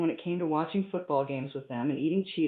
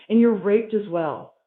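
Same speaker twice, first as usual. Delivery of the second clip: slow, louder gloating; triumphant; 3 semitones higher, 7 dB louder, slower than usual. Acted. Narrator gloats over beaten Marvin, slow and triumphant. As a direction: savor the win, each word slow and loud. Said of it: "I said triumphantly".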